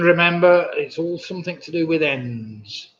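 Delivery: normal talking voice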